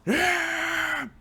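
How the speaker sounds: high rowdy cheer; pirate cheer. Acted. Pirates answering their captain, high rowdy cheer. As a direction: whoop like a mob of pirates.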